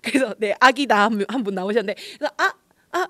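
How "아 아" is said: high, loud, halting exclamation; stall, then blurt it out awkwardly; imitating a stumped baby visitor; awkwardly lost for words. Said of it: "뒷말을 잊지 못하였다고".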